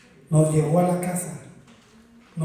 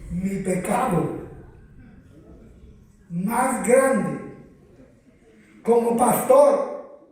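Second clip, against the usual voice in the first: building confession; 6 semitones higher, 5 dB louder than usual. Acted. Preacher confessing to fellow pastors, building to a shout. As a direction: climb from solemn confession to a shout.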